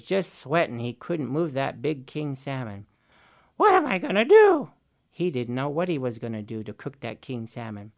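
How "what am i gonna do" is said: much higher, louder fretful cry; high, loud worried wail; despairing Raven. Acted. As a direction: trickster at his wits' end.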